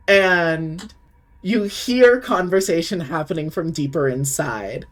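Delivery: normal talking voice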